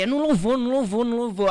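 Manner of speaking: loud, high, emphatic repetition; insistent retold protest. Guest retells insisting to God that his calling was praise, insistent.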